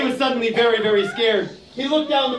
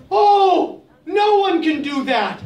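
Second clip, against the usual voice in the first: dismayed; 6 semitones higher, 3 dB louder, slower than usual. Acted. Giraffe dismayed.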